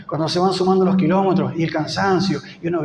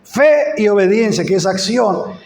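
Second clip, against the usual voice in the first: preaching; 3 semitones higher, 3 dB louder than usual. Acted. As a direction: build the appeal to the congregation. Preacher at full force.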